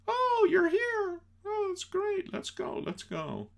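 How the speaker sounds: put-on girly falsetto. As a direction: squeak it like a giddy girl. Girlfriend voiced in film retelling.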